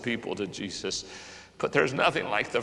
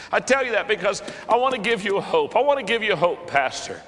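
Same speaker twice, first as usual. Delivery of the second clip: high, loud pleading preaching; preacher pleading with pastors and laypeople; fervent exhortation; 8 semitones higher, 3 dB louder than usual. Real, not acted. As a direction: urge them to take hope. Exhorting congregation.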